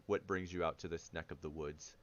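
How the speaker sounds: a hint of a deep, rumbling gentle giant; low rumbly voice. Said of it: "he says in a low, deep, rumbly voice".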